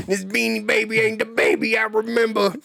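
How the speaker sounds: laughing high mock complaint. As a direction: giggle through a fake grumble. Joking grumpy nostalgia line.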